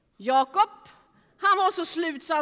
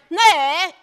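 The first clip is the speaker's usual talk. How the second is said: louder no; 8 dB louder than usual. Acted. James irritated at the man on the shore, calling out loudly. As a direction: call it out loud.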